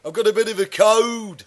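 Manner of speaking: hammed-up Lincolnshire yokel, punching "code"; Lincolnshire accent. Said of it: "as our hillbilly Lincolnshire friends would say".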